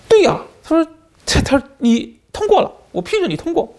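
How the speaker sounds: a cheerful official triumphantly announcing approval; excited officer. Retold or imitated. Officer proudly approves applicant. (移民官高声兴奋地宣布：对呀，你通过了)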